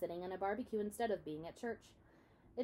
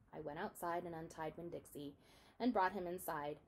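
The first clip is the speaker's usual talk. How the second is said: usual speaking voice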